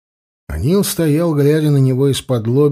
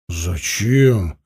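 lower, mildly puzzled question; surprised; 5 semitones lower than usual. A narrator's reading. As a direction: ask with understated surprise. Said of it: "удивился Анил". (со сдержанным удивлением)

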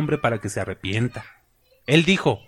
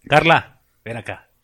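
calling out; 7 dB louder than usual. Real, not acted. Preacher calling Carla over mid-sermon, loud call. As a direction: call her over across the church. Calling someone across the room.

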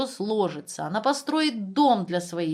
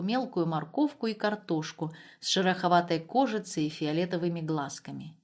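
plain reading voice

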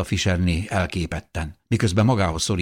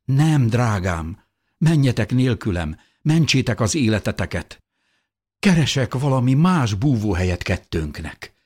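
higher, emotional pleading; tearful pleading; 5 semitones higher, 3 dB louder than usual. A narrator's reading. Wife begs husband to save himself, tearful. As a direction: plead with them to go. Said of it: "mondta Fischerné könnyes szemmel".

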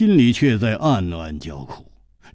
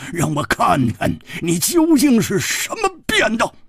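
forceful demand; 11 semitones higher than usual. Acted. Interrogator demands unmasking.

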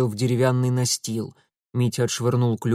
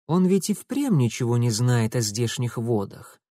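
ordinary delivery

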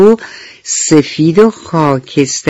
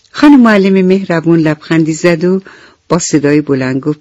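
plain reading voice

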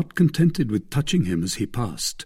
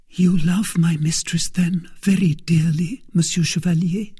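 wistful whisper; 7 semitones higher, 4 dB louder than usual. Acted. Kitty sighing, whispering.

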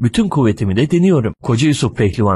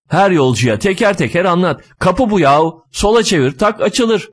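higher-pitched, exasperated nagging; 6 semitones higher than usual. Acted. Driver explains the door to every passenger, nagging. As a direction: keep griping as you explain the obvious. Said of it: "Şoförün çenesi kapanmıyor bir türlü".

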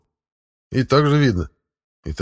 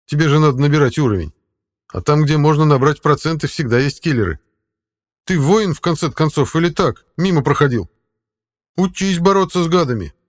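higher, louder voice for the talking cat; 7 semitones higher, 4 dB louder than usual. Acted. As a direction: play the sly cat guide. Cat character voice.

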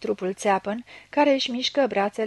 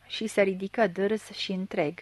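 plain reading voice

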